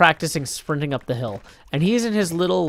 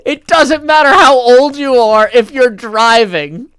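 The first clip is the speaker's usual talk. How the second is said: loud, high, slow outraged imitation; mad runner yelling; 10 semitones higher, 14 dB louder than usual. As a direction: yell back at coach in exasperation, stretching the words. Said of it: "the guy's just so mad at him".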